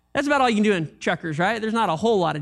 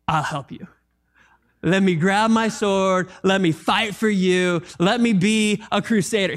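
a lightly voiced overeager disciple, lower; eager Peter voice; 3 semitones lower than usual. Preacher voicing Peter offering his sword, lightly eager. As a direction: play Peter as a lightly voiced would-be hero. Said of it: "we sometimes get this attitude".

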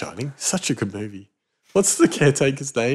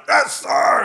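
high military yes sir, barked out; guard's shouted reply; 11 semitones higher than usual. Retold or imitated. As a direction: report like a prison officer on parade. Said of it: "and they're all loud".

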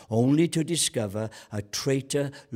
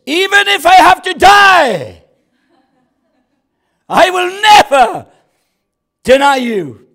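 huge high shout, far louder; Peter's boast; 18 dB louder than usual. Retold or imitated. Preacher voicing Peter, shouting his boast. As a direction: bellow the vow at full stretch.

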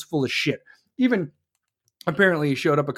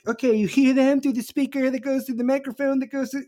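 mock podcaster; 8 semitones higher than usual. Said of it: "be like".

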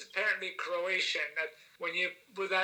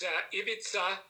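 normal talking voice

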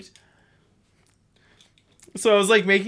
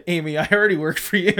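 giggling through the line; laughing; 3 semitones higher, 3 dB louder, faster than usual. Real, not acted. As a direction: laugh while reading it quickly. Reading a funny line, laughing.

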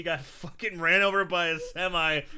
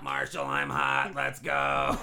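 deep, slow raspy smoker voice; smoker's rasp; 14 semitones lower, slower than usual. Retold or imitated. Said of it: "Beth has a smoker's voice".

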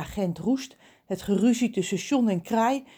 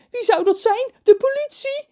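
panicky; 8 dB louder than usual. Acted. John paniekerig.

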